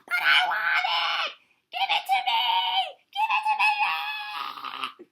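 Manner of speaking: full-out screamed demand, very high; screaming tantrum. A narrator's reading. Turtle screams at Walrus and Vulture, wild tantrum.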